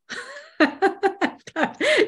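a burst of laughter at herself; high bright laugh. Real, not acted. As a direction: laughs aloud, high-pitched. Laughing at her own remark.

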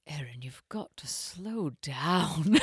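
put-on hushed, very slow British accent; low, slow, whispery British reprimand; British teacher. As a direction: play a breathy, drawn-out British dialect teacher. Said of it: "the British teacher was like".

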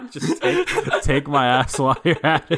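high, laughing squeal. Retold or imitated. Joking, laughing hard.

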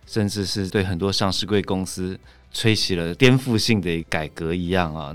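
normal talking voice

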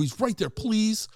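high, drawn-out plea; retold begging. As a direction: plead with the ticket guy, stretching it out. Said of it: "I'm begging the will call guy".

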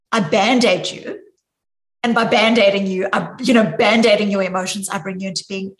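loud mock fixer; mocking band-aid version. Shown as a demonstration. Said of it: "It isn't".

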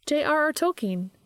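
chipper, lifted character voice; bright answer. A narrator's reading. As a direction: answer brightly, pitched well up. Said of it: "she answered brightly".

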